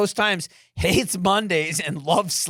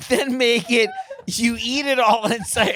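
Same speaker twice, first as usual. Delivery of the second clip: usual speaking voice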